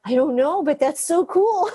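imitating your own thrilled reply; retold excitement. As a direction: marvel at it, stretching the end out. Retells own thrilled reply to patient.

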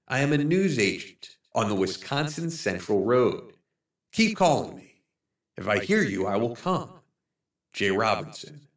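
softly voiced plea from a man lost in the woods; lost man's note. Lost man writing to his searchers, soft pleading voice.

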